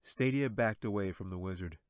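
ordinary delivery, nothing marked

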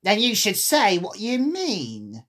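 admonish, drawing out the last word; emphatic storybook creature; March Hare. A narrator's reading. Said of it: "said the March Hare".

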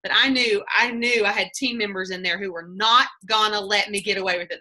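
usual speaking voice

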